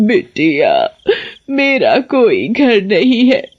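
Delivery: put-on slow, frail, self-pitying old voice; pitiful old woman. Witch posing as a thrown-out old woman to the girl, frail. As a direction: witch playing for sympathy.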